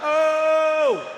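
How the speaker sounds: high, loud mock yell from behind; friends yelling. Friends behind yelling at him from their horses, high loud yell. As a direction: friends shouting faint warnings. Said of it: "They're like".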